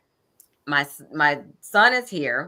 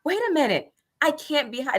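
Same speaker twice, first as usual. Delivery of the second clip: rationalizing; 9 semitones higher than usual. Retold or imitated. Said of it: "trying to rationalize with myself".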